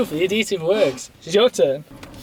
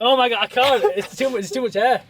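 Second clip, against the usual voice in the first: nervous laughing; 5 semitones higher than usual. Real, not acted. Scared to pull tape.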